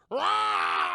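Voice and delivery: loud, high imitated roar; full-voiced mock monster bellow. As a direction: roar like an attacking kaiju.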